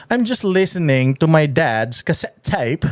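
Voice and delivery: slow, exaggerated fake British English; mock British accent. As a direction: imitate her put-on British accent, drawn out. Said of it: "Kasi ang sabi niya". (mabagal na panggagaya sa pekeng British accent)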